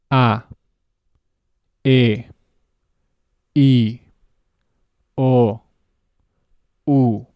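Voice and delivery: short, pure Spanish vowels, loud and crisp; Spanish A, E, I, O, U with no glide; exaggerated Spanish vowels. Shown as a demonstration. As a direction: clip each Spanish vowel short and clean. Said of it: "say them in Spanish also with a little bit of exaggeration".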